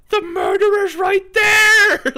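high desperate shout, slowed; yelling to be heard by people who can't hear; shrill pleading ghost shout; frantic yell. Retold or imitated. Ghost yells unheard at Batman.

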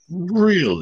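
high-pitched incredulous retold reaction; mock disbelief. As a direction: ask it with your voice leaping up in disbelief. Acted disbelief at the drooling man.

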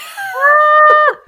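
high, loud burst of laughter; loud laughing shriek. Real, not acted. Connection drops, loud laugh.

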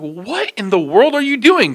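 high, slow, drawn-out disbelief; incredulous challenge. Retold or imitated. Deli worker confronting store manager, high incredulous challenge. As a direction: challenge him in hammed-up bafflement.

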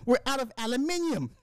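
high, put-on British voice; hammed-up falsetto British impression; mock British accent. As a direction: announce it as a pretend Brit, pitched high. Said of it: "imagine me telling the wife".

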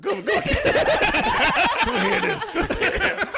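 high, giggling come-here calls; laughing so hard the words pitch up high; laughing call-over. Retold or imitated. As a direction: wave your cousin over while cracking up. Calling cousin over, laughing.

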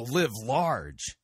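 slow, scornful mock catchphrase. Host challenging the preacher's misuse of power, mocking. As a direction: drag out the catchphrase in mockery.

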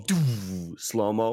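slow-mo drawn out very slowly; slow-motion speech. Shown as a demonstration. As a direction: stretch the words like a slow-motion shot. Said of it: "I can just do".

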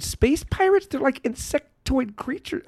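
very high, incredulous squeak; exasperated fan. Real, not acted. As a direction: voice shoots way up in exasperated disbelief. Exasperated fan defending Metroid lore.

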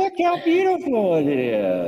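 mock her dreamy admiration; imitating friend. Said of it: "And I'm like".

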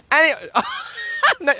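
laughing shout. Real, not acted. Laughing loudly.

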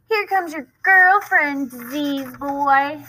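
high, slow sing-song jeer; mock him in front of the girl; taunting bully.

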